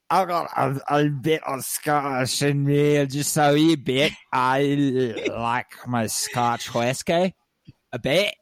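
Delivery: put-on broad, playful Scottish accent; hammed-up Scots, with drawn-out words; Scottish brogue. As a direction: roll it out in a mock Scottish brogue. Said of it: "I'm mixing my Scottish brogue".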